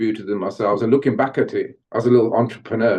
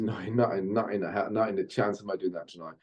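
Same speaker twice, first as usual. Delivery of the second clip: quiet refusal; 2 semitones lower, 10 dB quieter than usual. Retold or imitated. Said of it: "I said".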